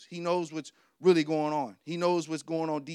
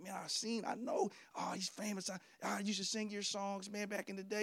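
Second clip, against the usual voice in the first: starstruck mumble; 3 semitones higher, 10 dB quieter than usual. Retold or imitated. Starstruck mumble at country singer.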